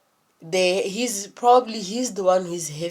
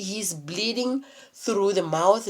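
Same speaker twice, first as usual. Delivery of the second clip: ordinary delivery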